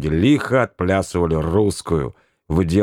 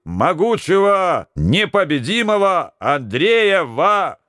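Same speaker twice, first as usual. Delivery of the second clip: solemn herald; 7 semitones higher than usual. Acted. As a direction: announce like a pompous herald. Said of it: "торжественным голосом Герольда возгласил".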